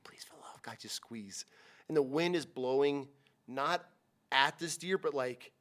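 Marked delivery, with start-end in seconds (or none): 0.0-1.5 urgent whispered plea, no voice at all; whisper in his ear. Retold or imitated. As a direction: breathe it into his ear, pleading. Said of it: "I'm in his ear, like".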